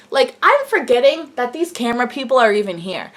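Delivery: ordinary talk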